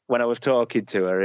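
ordinary talk, nothing marked

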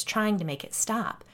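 ordinary delivery, nothing marked

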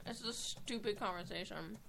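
talking through a mouthful; eating while talking; mouth full. Real, not acted. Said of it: "sorry, I'm eating".

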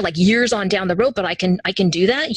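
usual speaking voice

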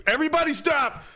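high call to stop everyone. Pretend. Calling the room to order.

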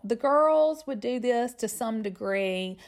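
ordinary talk

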